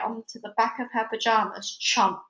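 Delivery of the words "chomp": usual speaking voice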